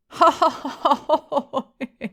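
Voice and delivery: loud, high belly laugh; uproarious laugh. A narrator's reading. Innkeeper laughing at the stranger's remark, uproarious. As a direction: roar with laughter. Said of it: "uproarious fit of laughter".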